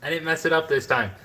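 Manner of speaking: thrilled little victory cry; excited. Real, not acted. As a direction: celebrate getting it right at last. Proud of finally cutting correctly.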